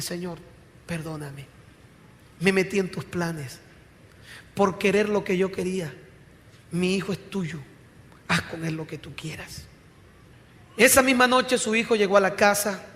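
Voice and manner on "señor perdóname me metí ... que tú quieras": retold tearful surrender. Mother yielding her son to God after weeping all night, subdued surrender. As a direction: give up quietly, voice sunk low with pauses. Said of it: "lloró como nunca y le dijo al Señor finalmente".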